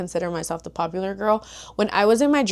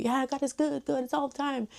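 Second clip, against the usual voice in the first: rote churchy phrase; 6 semitones higher, 7 dB quieter than usual. Retold or imitated. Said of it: "such a religious thing to say".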